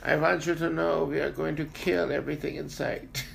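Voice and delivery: high, solemn, existential German narrator; deadpan grim documentary voice; Werner Herzog impression. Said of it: "He's very existential".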